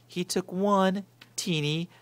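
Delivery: slow teasing build to the bite; suspenseful build. A narrator's reading. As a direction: stretch out the suspense.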